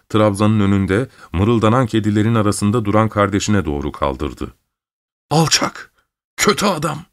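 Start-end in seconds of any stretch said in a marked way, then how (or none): 5.3-7.0 high-pitched, outraged cursing; angry cry. A narrator's reading. Mother curses the brother who hurt her child, crying out. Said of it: "diye bağırdı".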